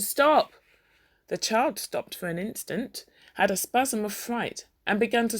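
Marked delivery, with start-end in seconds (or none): 0.0-0.5 sudden loud shout; angry father shouting stop. A narrator's reading. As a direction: bark it at the child. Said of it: "he shouted".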